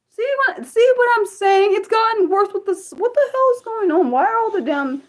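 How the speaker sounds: high, pleading exasperation at trolls; fed up and incredulous; high-pitched frustrated rant; exasperated. Real, not acted.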